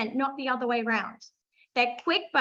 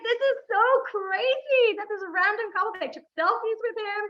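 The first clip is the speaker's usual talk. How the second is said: excited disbelief; 7 semitones higher than usual. Retold or imitated. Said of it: "I was like".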